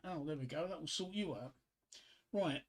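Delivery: ordinary talk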